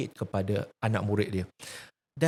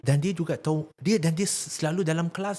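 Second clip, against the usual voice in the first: ordinary talk, nothing marked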